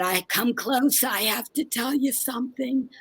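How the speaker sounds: soft, weak voice of a dying great aunt; dying aunt's hush. Retold or imitated. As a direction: breathe it like a last confession. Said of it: "on her deathbed said".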